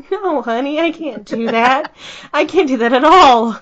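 laughing refusal. Retold or imitated. Mom answering son, laughing.

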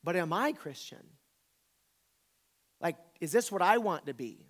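ordinary delivery

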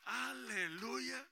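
slow, loud stretched aleluya; drawn-out hallelujah. Real, not acted. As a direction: stretch the praise out. Alabanza alargada. (aleluya alargado y fuerte)

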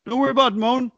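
high, breezy mock reassurance; playful high-pitched imitation; teasing high voice. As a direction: brush him off in a lilting high voice. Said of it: "He's like".

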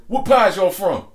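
high, loud mock interrogation; retold sharp challenge. Woman confronting newcomers in a retold story, loud and high.

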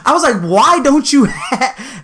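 high, incredulous rant breaking into laughter; exasperated and amused, pitched high; laughing disbelief. Retold or imitated. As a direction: crack up while demanding an explanation. Incredulous, laughing rant about golf shirts.

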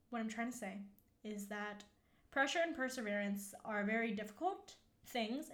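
ordinary talk

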